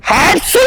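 high loud fake sneeze; sneezing. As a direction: explode into a sneeze. Prank sneeze on stranger.

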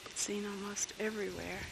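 worn-out voice barely there; losing voice. Real, not acted. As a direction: voice dropping to a whisper. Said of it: "I'm losing my voice".

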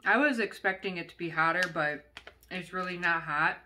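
talking while chewing; finishing a bite; mouth partly full. Real, not acted. Seen 0: Bit snack at -7; jaw works, lips close until 4.4.

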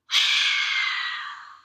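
loud, voiceless open-mouthed exhale; lion's breath sigh. Shown as a demonstration. Teacher demonstrating silly lion's breath, loud exhale. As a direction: push breath out with tongue stuck out. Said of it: "as you breathe out".

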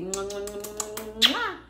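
playful run of smooching sounds rising to a big last one; blown kisses. Pretend. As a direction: hum out a string of affectionate kisses. Blows kisses to viewers.

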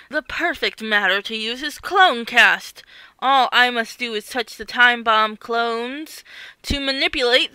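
loud gloating villain monologue; mustache twirling villain. Acted. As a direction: boast like a scheming supervillain. Villain Gravitina gloats over her plan.

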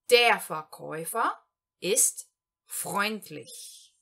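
Der Verkäufer ist freundlich in one slow flowing line; slow German sentence. Shown as a demonstration. Teacher reads the whole example sentence in one go, slowly. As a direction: read it whole, slowly and clearly. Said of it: "Primeiro exemplo".

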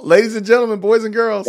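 ring announcer. Acted. Announcing the fighters.

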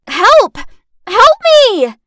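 high, very loud cry for help; panicked scream. Acted. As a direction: scream out, hysterical and desperate. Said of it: "I screamed".

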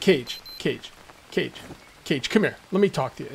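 lightly voiced viewers luring him over; mock viewers calling. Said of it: "and you guys said".

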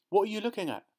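put-on high-pitched little boy's question; curious boy. A narrator's reading. Boy asking Dad why he stopped, high curious voice. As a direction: ask it high, like a curious child.

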